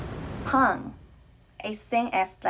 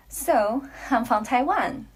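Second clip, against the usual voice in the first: usual speaking voice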